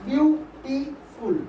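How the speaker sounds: "Beautiful" slowly as বিউ, টি, ফুল; split into three syllables. Shown as a demonstration. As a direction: pause between each part of "beautiful". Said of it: "তিনটে করে syllable হচ্ছে".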